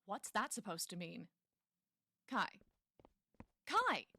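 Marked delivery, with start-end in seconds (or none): 2.3-4.1 loud, high call after someone walking away. Acted. Rebecca calls after Kai as he leaves, loud call. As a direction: shout the name to stop him.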